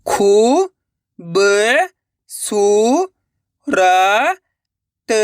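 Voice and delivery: long drawn-out syllables with pauses between. Shown as a demonstration. Teacher sounding out a word for learners, syllable by syllable. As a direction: sound out each syllable slowly and separately. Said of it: "एक एक अक्षर का उच्चारण करके".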